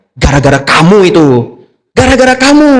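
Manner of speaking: acted blame, climbing high; wife scolding her husband, rising in pitch; nagging, angry wife. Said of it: "Dia ngomel-ngomel seharian". (istri mengomel, nada makin tinggi)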